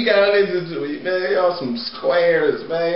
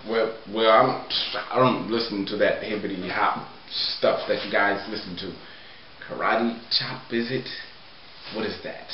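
lower, sniffy square who hates hip hop; 10 semitones lower, 8 dB quieter than usual. Acted. An old square scoffs at the hip hop song, low and sniffy.

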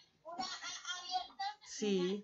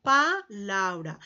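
"Palabra" said slowly, split after "Pa"; broken into parts; 2 semitones lower, slower than usual. Shown as a demonstration. Teacher prompting pupils with the word, slowly broken up. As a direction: stretch out "Palabra" with a break after the first syllable. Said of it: "al pronunciar una ¿qué?".

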